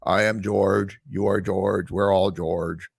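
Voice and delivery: low, very slow mocking impression; slow droning YouTuber. YouTuber mimicking a boring rival creator on camera, slow droning mockery. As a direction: imitate a ponderous, low-voiced talker. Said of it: "really, like, slow".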